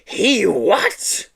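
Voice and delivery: high shrieked question. Acted. Teacher shrieking at the news, a mild shriek. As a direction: shriek in outrage. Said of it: "shrieked Miss Hatchet".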